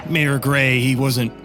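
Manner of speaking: ordinary talk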